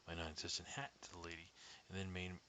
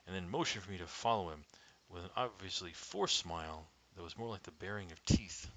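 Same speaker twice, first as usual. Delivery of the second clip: ordinary delivery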